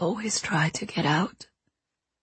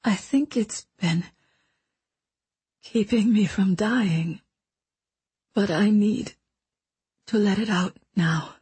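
weak dying whisper. Acted. Dying mentor confesses her past to the boy, weak whisper. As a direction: let each phrase cost her breath.

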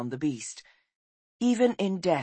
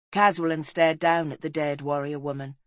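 ordinary delivery, nothing marked

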